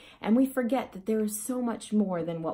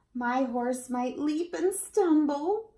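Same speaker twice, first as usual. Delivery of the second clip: put-on high, loud child's fear; frightened child; 7 semitones higher, 3 dB louder, slower than usual. A narrator's reading. Child tells citizens why they won't cross, high and scared. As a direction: voice a scared little rider.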